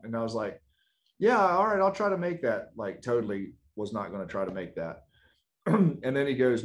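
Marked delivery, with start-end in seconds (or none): 1.2-2.7 high, louder, breezy fake yes; fake agreement. Student brushing off the throws coach, breezy fake agreement. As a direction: brush him off with a bright insincere yes.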